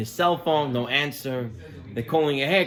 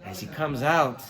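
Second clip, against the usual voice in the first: usual speaking voice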